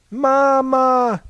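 loud, high, drawn-out call; a child's ringing cry to its mother. A narrator's reading. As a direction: call out for mama, both syllables held long. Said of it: "cried the captain".

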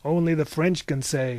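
usual speaking voice

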